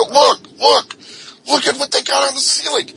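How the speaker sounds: high, loud, fast mocking shout; excited taunt. Prisoner taunting the cops, high, loud and fast. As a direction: jeer at the cops.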